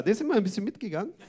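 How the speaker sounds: ordinary talk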